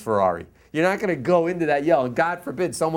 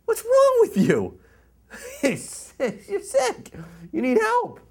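outraged scolding; much slower than usual. Retold or imitated. Outraged at man pushing blind.

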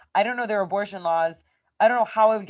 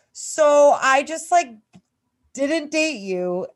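drawn-out, higher-pitched indignant retelling; indignant confrontation; 7 semitones higher, 5 dB louder, much slower than usual. Retold or imitated. Woman retelling how she confronted her old hookup, indignant. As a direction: spell it out slowly, incredulous.

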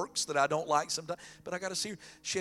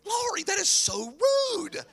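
high, slow scolding; mock scolding; 8 dB louder, slower than usual. Husband mock-scolding Lori in the car, high and slow. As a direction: draw it out high like a mock reproach. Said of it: "and I'm like".

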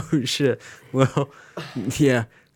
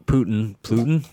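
ordinary delivery

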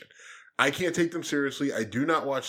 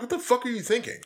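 high-pitched, exasperated challenge; indignant, raised-pitch outburst at a newsroom; incredulous demand; 10 semitones higher, faster than usual. Retold or imitated. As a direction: demand an answer in disbelief. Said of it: "I go to C N N, I'm like".

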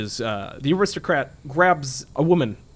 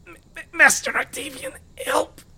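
very high, stammering creature cry for help; hurt minion's high-pitched, panicky plea; wounded lizardman; 4 dB louder than usual. Acted. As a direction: squeal for your master in a strained, high voice. Game master voices wounded lizardman.

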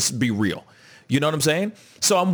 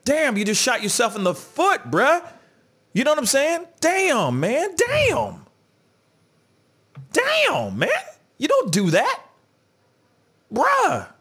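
much higher, stretched-out damn of disbelief; exasperated disbelief; 9 semitones higher than usual. Real, not acted. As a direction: shake your head at a friend's blunder. Exasperated reaction to [name].

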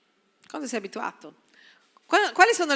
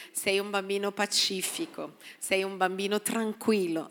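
put-on softer, calm words to a child; gentle blessing; 2 semitones lower, 7 dB quieter than usual. Pastor voicing a parent blessing a child, soft and calm. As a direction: speak peace over the child, softly.

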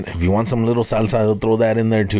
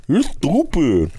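higher, very slow, goofy insult; goofier brother voice; 6 semitones higher, much slower than usual. Retold or imitated. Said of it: "He sounds goofier".

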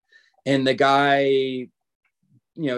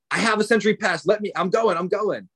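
higher, insistent push-past; retold insistence; 6 semitones higher than usual. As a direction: insist on getting through, retelling the standoff. Retold standoff, pushing past the men.

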